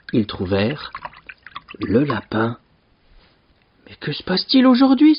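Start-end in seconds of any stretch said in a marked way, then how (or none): 3.9-5.1 high, quick exclamation of surprise; baffled, high-pitched outburst; astonished cry. A narrator's reading. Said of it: "s'écria Stéphane".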